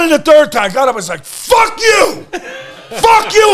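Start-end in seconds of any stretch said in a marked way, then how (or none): 1.2-2.3 triumphant yell. Retold or imitated. Wrestler who just won, shouting in triumph. 2.9-3.5 high, loud victory shout; triumphant yell. Retold or imitated. Wrestler who just won, shouting in triumph.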